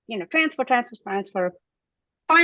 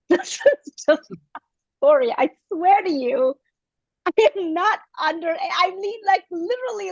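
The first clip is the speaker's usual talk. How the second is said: laughing nervously, voice climbing high; high, nervous laughter while swearing it's just a story; 7 semitones higher than usual. Real, not acted. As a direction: laugh it off in a panic, almost crying. Nervously denying abuse to lawyer.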